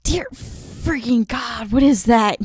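frightened shout at a monster; panicked yell. Acted. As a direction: blurt it out in a scared yell. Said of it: "yells in Infernal".

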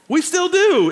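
high, loud insistence; emphatic shout. Real, not acted. Preacher driving his point home to the crowd, shouting. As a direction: nail it down, shouting.

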